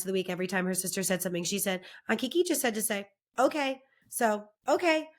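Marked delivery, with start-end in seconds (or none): 2.1-5.1 put-on higher little-girl retelling; imitated nine-year-old niece. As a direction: lift the voice into a child's proud comeback. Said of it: "because she's nine and she listened".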